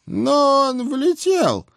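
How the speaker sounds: put-on slow, high menacing old hag; drawn-out grim witch retort; stern old witch. A narrator's reading. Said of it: "недобро сдвинув брови".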